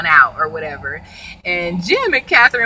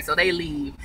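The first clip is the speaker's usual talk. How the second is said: ordinary talk, nothing marked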